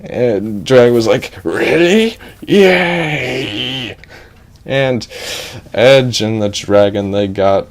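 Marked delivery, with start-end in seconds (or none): 1.4-4.0 excited. Acted. Dragon delighted by Edge.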